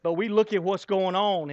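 normal talking voice

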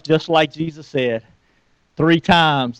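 ordinary delivery

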